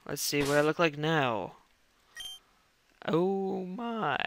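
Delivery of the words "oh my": a real crack in the voice. Real, not acted. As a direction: voice cracking on a high "oh my". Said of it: "My voice keeps on cracking".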